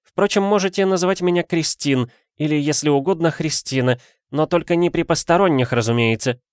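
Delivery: high-pitched lady's voice; capricious madame. A narrator's reading. Madame allowing detective her first name, condescending. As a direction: grant the favour in a high voice.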